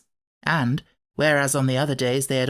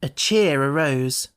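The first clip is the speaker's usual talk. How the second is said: plain reading voice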